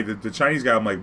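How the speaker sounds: usual speaking voice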